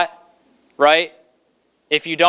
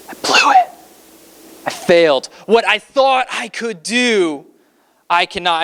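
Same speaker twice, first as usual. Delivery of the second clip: anguished realization; 3 semitones higher than usual. Acted. Peter realizes he failed.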